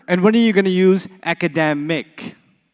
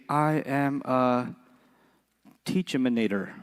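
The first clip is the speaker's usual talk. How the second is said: very slow, soft "teachaminator"; mock proud wrong word; 7 semitones lower, 11 dB quieter, slower than usual. Shown as a demonstration. Teacher mocking a made-up job title, drawn out slowly. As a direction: stretch it out like a proud new title. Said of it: "Somebody says".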